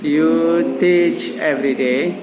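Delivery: slow, measured sentence for repeating; drawn-out teaching example; slow model phrase. Shown as a demonstration. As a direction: say it slowly for learners to echo.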